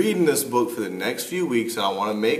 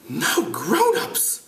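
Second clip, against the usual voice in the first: slow, high British schoolboy; amateur British accent, drawn out high; 8 semitones higher, much slower than usual. Acted. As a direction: draw it out as a British boy. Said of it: "try to do it with a British accent".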